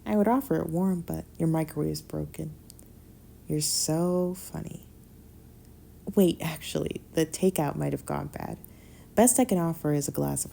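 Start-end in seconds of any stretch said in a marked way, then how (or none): none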